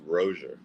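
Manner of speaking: Rozier said "ROH-zher", in two syllables; two-beat Rozier, "ROH-zher", R sounded at the end; correct name pronunciation. Shown as a demonstration. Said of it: "it's actually pronounced".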